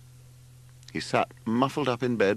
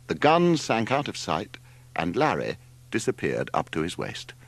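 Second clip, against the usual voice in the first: plain reading voice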